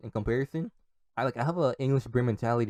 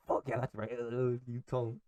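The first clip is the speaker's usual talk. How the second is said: proud dad; 6 dB quieter than usual. Acted. Dad backing his kid at the restaurant, quietly proud. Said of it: "this little proud moment for my dad".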